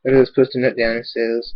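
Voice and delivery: ordinary delivery, nothing marked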